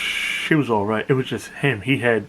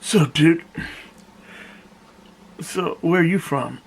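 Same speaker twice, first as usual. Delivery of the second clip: stranger's voice; 6 semitones higher than usual. Retold or imitated. Stranger asking narrator, voiced.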